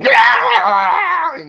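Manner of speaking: high, loud mock scream; imitated scream. Host mimicking his broken co-host, mock scream. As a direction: mimic a man screaming into the mic. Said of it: "want him to just keep".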